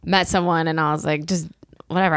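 normal talking voice

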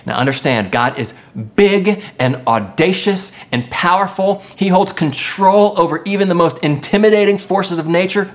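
ordinary delivery

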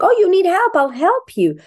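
put-on high, lively parent-to-child voice; helpful parent. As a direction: jump in eagerly as a parent helping a toddler. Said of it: "And the parent's like".

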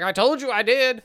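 high, loud, insistent; indignant Bob. Retold or imitated. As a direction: insist he's right. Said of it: "He's like".

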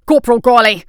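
loud sharp call. A narrator's reading. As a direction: bark the name across the room. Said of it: "Captain Joyner said sharply".

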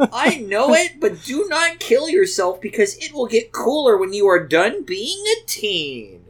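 high, pleading mock-tearful mom; very sad, meaning it. A narrator's reading. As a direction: plead earnestly, hammed-up sorrow. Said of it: "in a very sad and meaning it way".

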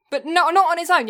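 ordinary delivery

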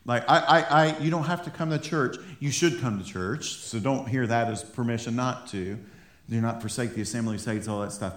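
ordinary delivery

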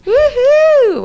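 loud, high whoop; excited cheer. Real, not acted. Cheering the movie announcement.

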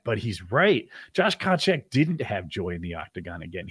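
ordinary delivery

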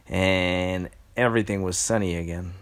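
plain reading voice

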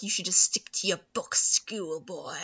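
low sneering mock villain voice; lightly voiced snooty villain. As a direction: look down your nose at him. Preminger sneering at Julian.

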